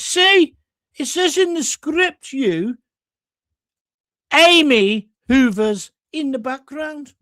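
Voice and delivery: smug high Brummie, pointing at the script; Brummie cleaner. Retold or imitated. Cleaner showing the director her script, high slow Brummie. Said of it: "picks up the script and says".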